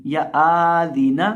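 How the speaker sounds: आदीना stretched slowly with a rising end; wrong drawn-out reading. Shown as a demonstration. Teacher showing another mistaken reading: आदीना stretched slowly. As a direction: pull आदीना out long, the wrong way. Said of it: "इस तरह नहीं पढ़ेंगे".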